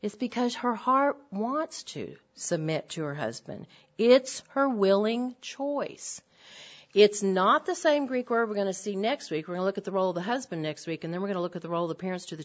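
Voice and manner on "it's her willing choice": slow emphasis. Real, not acted. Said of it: "slow emphatic point".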